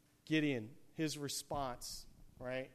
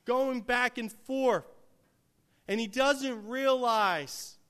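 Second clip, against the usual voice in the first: louder, higher, slowed preaching climb; full-force preaching; 8 semitones higher, 10 dB louder than usual. Acted. Preacher stirring the congregation, louder and higher. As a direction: lean into every phrase like a revival preacher.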